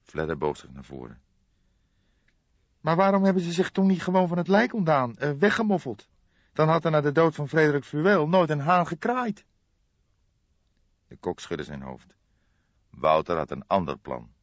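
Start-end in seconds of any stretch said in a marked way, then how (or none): 2.8-9.5 much higher character voice, a puzzled question; Fledder's voice. A narrator's reading. Detective Fledder asks the cook why they kept the body, high voice. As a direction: pitch the inspector way up and ask it straight.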